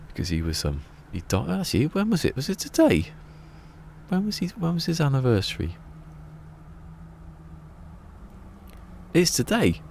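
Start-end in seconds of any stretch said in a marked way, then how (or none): 1.5-9.8 much higher, anxious questioning; suddenly unsure, fretting; flustered realization. Real, not acted. Realizing [name]'s anniversary, flustered high.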